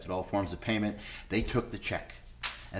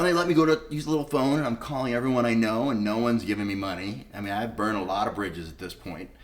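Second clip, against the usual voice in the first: normal talking voice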